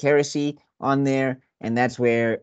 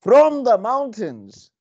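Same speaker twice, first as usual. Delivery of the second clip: epic announcer voice; 2 semitones higher than usual. Shown as a demonstration. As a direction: boom it like a movie trailer. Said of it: "but I do have this".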